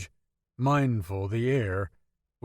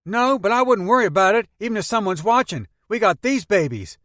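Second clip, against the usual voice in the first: put-on high, lively teenage boy voice; young Peter talking about his grandma; 10 semitones higher, 9 dB louder than usual. A narrator's reading. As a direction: play the chatty kid in the back seat.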